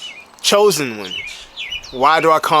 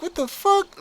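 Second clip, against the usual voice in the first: high disbelief; slower than usual. Retold or imitated. Reacting to bodybuilder claim.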